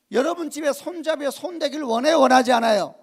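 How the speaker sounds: a pastor questioning the congregation, loudly; loud preaching question. Acted. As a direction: press the people for an answer. Asks the church loudly.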